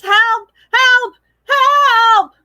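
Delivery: high, loud shouts; shouting for help. Acted. Animals calling the farmer, loud shouts. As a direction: yell at the top of your voice. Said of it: "cried at the tops of their voices".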